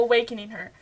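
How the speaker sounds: ordinary delivery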